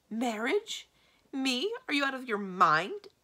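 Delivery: loud, high incredulous questions; appalled disbelief. A narrator's reading. Magician reacts to secretary's marriage idea, appalled. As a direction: fire back in outraged disbelief.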